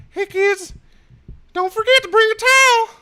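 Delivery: very high, loud cartoon squeal; South Park voice. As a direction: squeak it like a stoned cartoon character. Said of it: "Do the South Park voice".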